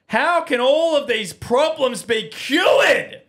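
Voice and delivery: high, loud, slow dramatic ad question; ad pitch shout. Acted. Host hyping the fake sponsor product, dramatic shout. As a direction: build to a big sales-pitch climax. Ad climax.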